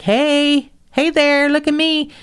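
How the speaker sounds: high, loud mock hey there; mock calling out. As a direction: wave for attention, calling high. Calling out at front desk.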